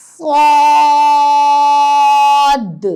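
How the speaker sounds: صاد loud and drawn out with a thick emphatic s; heavy saad. Shown as a demonstration. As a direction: stretch صاد heavy and full. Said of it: "صاد بھی موٹا پڑھا جائے گا".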